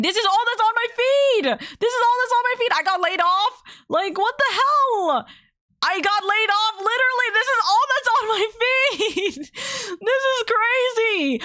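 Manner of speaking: stunned high voice reading the layoff posts; high disbelief. Real, not acted. Repeating feed posts in disbelief.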